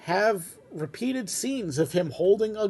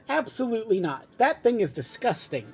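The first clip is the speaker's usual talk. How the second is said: higher-pitched, disgusted refusal; firm disgust; 6 semitones higher than usual. A narrator's reading. Martha rejecting the Grinch's junk, disgusted. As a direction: turn it down in a raised pitch.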